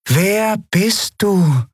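high, slow, otherworldly question; strange inhuman voice. A narrator's reading. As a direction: ask slowly, eerily sing-song. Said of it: "eine fremde und gleichzeitig seltsam vertraute Stimme".

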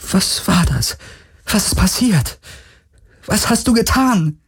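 high, rising panicked questions; scared woman demanding answers after the horror; frightened. Acted. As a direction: ask in mounting fear, voice climbing.

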